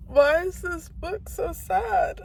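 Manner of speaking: high, slow, weepy words through real tears; tearful crying voice. Real, not acted. To the camera, overwhelmed by a sad book chapter, tearful. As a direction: cry it out, half laughing at yourself. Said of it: "show proof that I'm crying".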